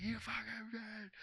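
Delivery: held-back high mock yell; strained mock yell. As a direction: imitate yelling at half volume. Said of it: "like, yelling at her".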